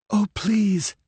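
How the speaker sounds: put-on high, pleading young woman's voice; begging. A narrator's reading. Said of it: "she begged".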